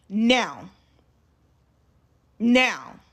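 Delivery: loud, firm retold command; going off. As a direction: order him sharply, one word at a time. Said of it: "am going off".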